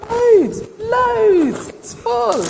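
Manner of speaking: high, loud amazement; amazed. Acted. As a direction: gush with surprise. Amazed at result.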